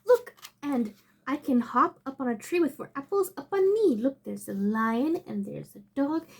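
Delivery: ordinary delivery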